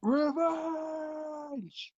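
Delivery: high, drawn-out dramatic revenge call; hammed-up villain cry. Shouting word back dramatically.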